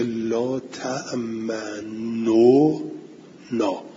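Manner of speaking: لا تأمنو نا said slowly, the damma on تأمنو sounded fully before نا; لا تأمنو نا drawn out slowly with a full damma on the nun; original form with damma. Shown as a demonstration. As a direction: spell out لا تأمنو نا with a clear u vowel, then نا. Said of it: "یعنی این بوده".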